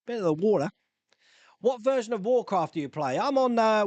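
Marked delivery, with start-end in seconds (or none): 0.1-0.7 put-on Australian accent. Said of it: "speaking like an Australian".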